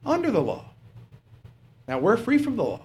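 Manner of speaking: normal talking voice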